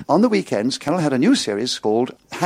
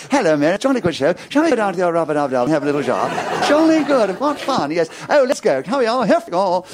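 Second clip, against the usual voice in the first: mock upper-class English film hero, high and bright; plummy fifties film voice; 5 semitones higher than usual. Actor mocking his own old films in a plummy jolly voice. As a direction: ham up a jolly posh chap from an old film. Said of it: "talking like that".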